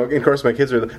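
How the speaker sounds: normal talking voice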